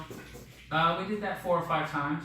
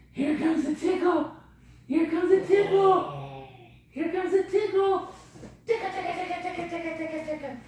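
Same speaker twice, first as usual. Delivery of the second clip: put-on high playful baby talk. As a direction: sing the tickles up high for a toddler. Tickling game with the toddler.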